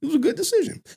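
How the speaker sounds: high, hammed-up gushing friend; mocking his friends. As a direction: parody a sycophantic buddy. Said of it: "That's what his friends be saying".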